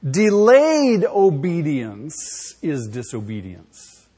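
slow, every word spaced; slow declaration. Shown as a demonstration. Preacher setting the line for the church to repeat, slow. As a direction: drop each word slowly. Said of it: "Say that with me".

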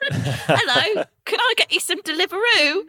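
high, hammed-up British accent with a laugh in it; mock British accent. Teasing the quirky British delivery name, mock British accent. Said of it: "That is the most British thing".

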